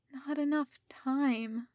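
soft, slow and miserable, barely voiced; miserable whisper. A narrator's reading. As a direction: say it as if all hope is gone, slowly and softly. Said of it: "Rodney whispered miserably".

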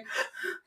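high, hammed-up wailing cry; exaggerated reenacted bawling; mock sobbing. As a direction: fake a long high sob. Acting out sobbing while folding laundry.

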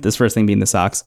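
usual speaking voice

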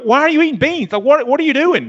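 high, loud, incredulous questioning; freaking out. Retold or imitated. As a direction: imitate a panicked companion demanding answers. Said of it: "like, freaking out".

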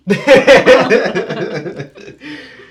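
loud laugh. Real, not acted. Partner laughs at the joke.

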